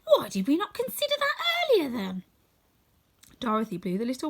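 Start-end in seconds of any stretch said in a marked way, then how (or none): 0.0-2.2 much higher and louder, an eager straw man; a bright silly cry, kicking himself; Scarecrow. Acted.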